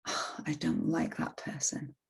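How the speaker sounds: secret whisper of dislike; whispered aside. Retold or imitated. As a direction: breathe it like a guilty thought. Inner voice confessing dislike.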